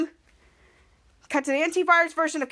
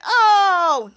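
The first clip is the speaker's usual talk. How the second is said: disgusted; 4 semitones higher, 7 dB louder than usual. Retold or imitated.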